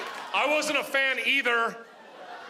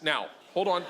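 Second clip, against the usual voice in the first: ordinary delivery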